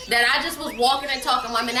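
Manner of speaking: ordinary delivery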